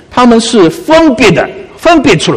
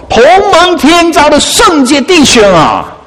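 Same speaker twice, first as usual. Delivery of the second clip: high, drawn-out exclamation; heartfelt, ringing scripture quote; stirred verse reading; 7 semitones higher, slower than usual. Acted. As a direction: proclaim the verse slowly, pitched high. Preacher reads verse as thrilling.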